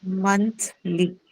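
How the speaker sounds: "Monthly" said slowly in two separated syllables. Shown as a demonstration. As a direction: split "Monthly" into Month, then ly, with a gap. Teacher breaks word up for students.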